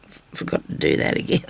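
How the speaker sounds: ordinary talk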